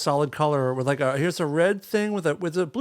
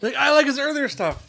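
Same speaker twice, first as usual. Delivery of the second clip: pitched up, mock pretentious art lover; mock snooty; 10 semitones higher, 3 dB louder than usual. Teasing friend by aping her art-snob line. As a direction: parrot the pretend art fan.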